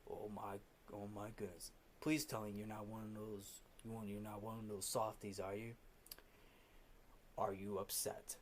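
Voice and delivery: very soft, low mock concern; acted-out bully speaking low and soft; quiet taunting. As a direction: taunt him in a hushed, low voice. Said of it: "Fletcher is taunting Neiman".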